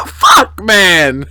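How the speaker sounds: real shout of exasperation; exasperated shout. Real, not acted. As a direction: blurt it out loud. Shout after laughing.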